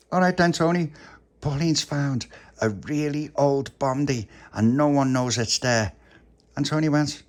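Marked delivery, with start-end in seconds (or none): 0.1-5.9 high, slightly loud news-breaking; lightly voiced excited youngster; eager puppy. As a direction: announce a find to a friend. Puppy shares secret with Tony.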